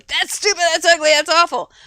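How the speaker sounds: mock outburst of contempt; harsh critic. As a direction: voice a scornful critic, loud and high.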